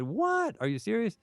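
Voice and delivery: sudden jump way up on "What?", then stays high; shocked disbelief. Retold or imitated. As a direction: play a stunned outraged emperor. Napoleon reads insulting telegram.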